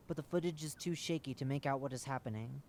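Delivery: plain reading voice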